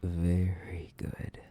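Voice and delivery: slow whispered praise; slow hushed praise; slow approving whisper. Acted. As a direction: purr your approval slowly. Praising obedient captive.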